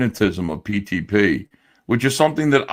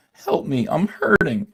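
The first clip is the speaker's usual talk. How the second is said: high, whimpering plea; mock whining victim; 6 semitones higher, slower than usual. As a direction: whine like a helpless victim. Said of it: "where it's like".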